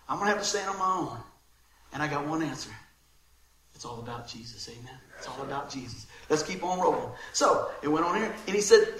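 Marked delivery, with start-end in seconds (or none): 3.7-6.1 much softer, much lower, nearly under the breath; quiet sincere conviction; hushed and earnest. Real, not acted. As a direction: bring it down to a reverent hush.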